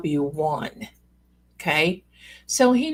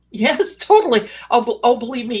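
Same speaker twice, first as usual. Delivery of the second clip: louder, higher gushing agreement; acted eager reply; 3 semitones higher, 7 dB louder, faster than usual. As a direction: gush it with a little laugh. Acts out her eager reply.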